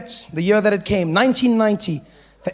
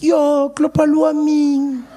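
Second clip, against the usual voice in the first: friend Snowflake; 7 semitones higher, much slower than usual. Retold or imitated. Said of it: "Snowflake was like".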